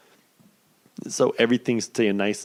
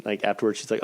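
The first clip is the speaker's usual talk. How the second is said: usual speaking voice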